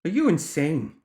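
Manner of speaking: incredulous outcry; protesting. A narrator's reading. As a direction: object in disbelief, voice jumping up. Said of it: "O'Duggan protested".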